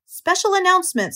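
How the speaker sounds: high-pitched, pompous loudspeaker voice; pompous fairground announcement; pompous announcer. A narrator's reading. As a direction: announce it with great self-importance. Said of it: "said the loudspeaker in a pompous voice".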